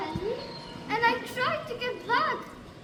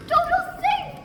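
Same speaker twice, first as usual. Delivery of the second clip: excited; 7 semitones higher, 8 dB louder than usual. Acted. Relieved joy at dog's safety.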